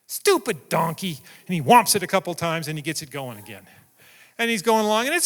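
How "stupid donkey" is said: slow, grumbling "Stupid donkey"; a slow, fed-up mutter at the animal; muttered annoyance. Retold or imitated. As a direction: grumble at the stubborn donkey, slowly.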